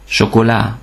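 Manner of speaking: chocolat starting with "sh", silent T. Shown as a demonstration. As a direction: say chocolat as "sho-ko-la". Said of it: "H betűcsoportot S-nek ejtjük".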